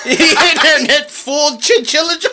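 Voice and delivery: laughing. Real, not acted. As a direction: tell it while laughing. Laughing retelling.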